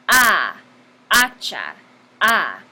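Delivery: loud A, then HA-cha with a punched first syllable; stress on first syllable. Shown as a demonstration. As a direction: punch the "A" in "hacha", long and falling. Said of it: "La sílaba con más énfasis es la primera".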